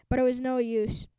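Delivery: plain reading voice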